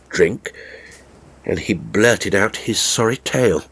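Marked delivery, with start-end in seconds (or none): none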